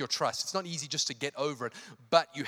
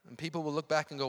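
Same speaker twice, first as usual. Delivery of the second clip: ordinary delivery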